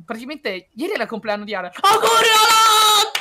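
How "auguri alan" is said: slow, higher, loud cheer; birthday shout. Real, not acted. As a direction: shout the wish out slowly. Loud birthday wish retake.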